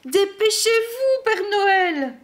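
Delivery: high, anxious storybook plea; worried. A narrator's reading. Reindeer hurrying Santa before night ends, worried. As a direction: urge him on in a high, fretful voice. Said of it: "s'inquiètent les rennes".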